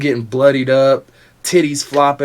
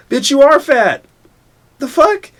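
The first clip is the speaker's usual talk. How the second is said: loud, very high-pitched retort; hammed-up exasperated shout; incredulous comeback; 13 semitones higher, 7 dB louder than usual. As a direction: voice jumps up in mock outrage. Yelling back at the mocked crybaby.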